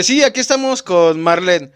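loud, high host voice breaking into laughs; Chiquirulo impression. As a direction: play the giggling vlogger introducing his guests. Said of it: "así es el Chiquiculo en sus videos".

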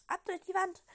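loud, high-pitched call; lively read-aloud shout; rallying call. A narrator's reading. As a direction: call it out to get everyone moving. Girl's rallying call in the story.

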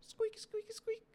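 high squeaky rubbing noise; squeaky rubber duck. Acted. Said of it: "let's give it a rub-a-dub-dub".

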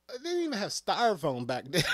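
ordinary talk, nothing marked

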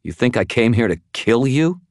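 high, incredulous, disgusted question; indignant question climbing at the end. Acted. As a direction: throw the accusation back in disbelief. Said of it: "sounded at least half as disgusted".

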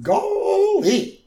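high, loud, drawn-out exclamation; Gomer Pyle. Retold or imitated. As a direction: stretch it out in goofy amazement. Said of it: "Gomer Pyle".

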